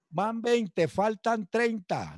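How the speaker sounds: slow, high drill-sergeant call; mock sergeant count. Sergeant counting recruits, retold.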